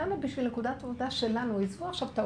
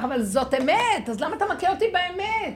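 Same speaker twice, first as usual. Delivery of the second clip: indignant protest; 9 semitones higher, 6 dB louder than usual. Retold or imitated. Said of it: "בגלל שאמרתי כך וכך".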